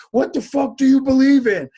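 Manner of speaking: high, loud mock yell; angry shout. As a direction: bark it at a stranger like a threat. Acting someone with a bat.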